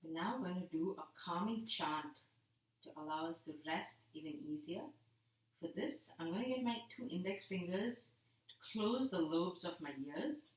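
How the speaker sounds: a yoga teacher settling the class into rest; soft relaxation guidance. Acted. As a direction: ease them into rest gently. Soft relaxation instructions lying down.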